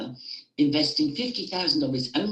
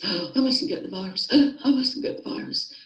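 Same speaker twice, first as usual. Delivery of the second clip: mock panicked gasping; 8 semitones higher than usual. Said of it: "all you can think of every waking moment is".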